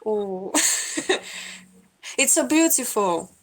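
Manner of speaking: ordinary delivery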